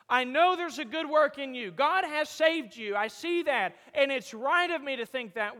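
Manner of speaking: loud, emphatic assurance; fervent preaching. Acted. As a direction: declare it boldly to the church.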